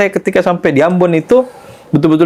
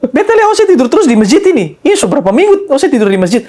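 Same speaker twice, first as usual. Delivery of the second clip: very high imitated Ambonese voice; high-pitched Ambonese lilt; Ambonese accent; 10 semitones higher, 3 dB louder than usual. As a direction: imitate the old man quizzing in Ambonese dialect, pitched high. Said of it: "Pakai logat Ambon kan".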